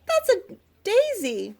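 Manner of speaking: high, slow insistence; Little Mouse. A narrator's reading. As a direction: correct them in a high little storybook voice. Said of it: "said Little Mouse, shaking her head".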